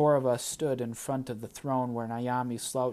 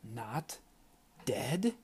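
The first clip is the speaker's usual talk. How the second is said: hissed; 4 dB quieter than usual. A narrator's reading. Ayanna hissing at Tristan for hiding it, soft and angry. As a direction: spit it through your teeth, barely voiced. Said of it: "she hissed".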